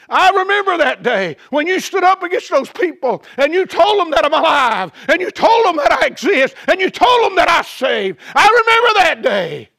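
God proclaiming the beggar's witness; rising shout. Acted. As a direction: preach it out with fire.

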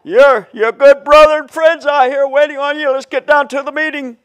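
loud, high, fast fake-cheerful wake-up call; hammed-up insincere greeting shouted high and fast; mock cheery yelling. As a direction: holler a phony good morning at the whole room. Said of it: "you're going around yelling at everybody".

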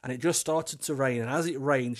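ordinary delivery

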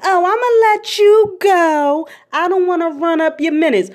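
grandma cutting the call short; grandma brushing off. Retold or imitated. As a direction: chirp it like a grandma eager to hang up. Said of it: "She be like".

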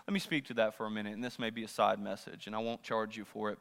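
low, soft, quick side note; quiet aside. Real, not acted. Preacher setting aside the sermon briefly, quiet aside. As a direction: drop right down and slip it in quickly.

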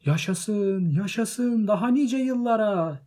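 high, loud, drawn-out cheer; joyful shouting. A narrator's reading. Happy guests cheering Bilbo's birthday, very high jubilant shout. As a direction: cheer at full voice like a whole party. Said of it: "diye bağırıp masaları neşe içinde yumrukladılar".

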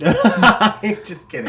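high, slow, laughing through the words; real laughter taking over the line. Real, not acted. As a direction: giggle out the retraction. Laughing off a joke.

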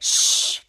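drawn-out voiceless shush; hushing. Retold or imitated. Ray shushing kids during his ad, long hush. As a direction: hush everyone in the room. Ray hushes the others in recap.

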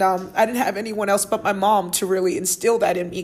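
normal talking voice

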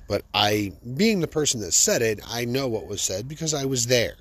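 ordinary talk

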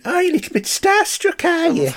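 very high-pitched, hammed-up Irish; bad Irish accent. Podcaster mocking the hologram, exaggerated bad Irish accent. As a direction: mimic a terrible stage-Irish accent way up high. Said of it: "my impersonation of him doing a bad accent".

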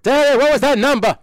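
put-on high, very loud old-man holler; hammed-up frantic yelling; mock exasperated yell. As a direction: shout across the house for help. Acting old man yelling for number.

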